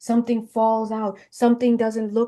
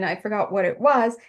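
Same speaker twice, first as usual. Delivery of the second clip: usual speaking voice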